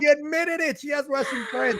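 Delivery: playful high mock tattletale; mock accuser. Host teasing guest about her Russian friends, mock accusing. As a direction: sound like a gleeful snitch outing her.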